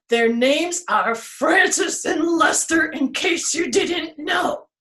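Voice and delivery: high, slowing tree voice ending in a shout; tree informing everyone. Acted. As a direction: announce the names with flair. Tree introduces friends.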